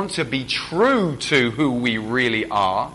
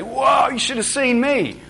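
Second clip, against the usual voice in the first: much higher, slow bragging whoa; boastful party-goer; 11 semitones higher, 4 dB louder, slower than usual. Retold or imitated. A drinker boasting at work about last night, slow and high. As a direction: brag like a hungover mate at work. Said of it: "coming in with a story at work".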